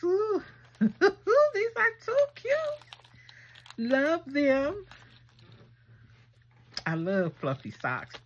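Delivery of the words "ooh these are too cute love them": very high and very slow, drawn-out squeal; giddy high-pitched gush; delighted squeal. Real, not acted. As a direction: melt over the fluffy socks. Squealing over fluffy socks.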